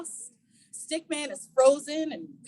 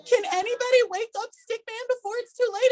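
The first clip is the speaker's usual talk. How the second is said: ordinary delivery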